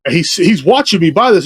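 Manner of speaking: ordinary talk, nothing marked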